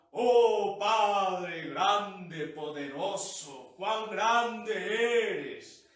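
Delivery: booming, drawn-out prayer voice; hammed-up loud praise, every word stretched out; put-on praying voice. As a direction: pray like someone whose voice transforms, loud and grandiose. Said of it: "cuando ora le cambia la voz".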